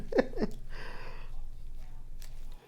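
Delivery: quiet, high chuckle; real soft laugh. Real, not acted. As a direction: laugh softly at your own joke. Laughs after joke about vanishing coleslaw.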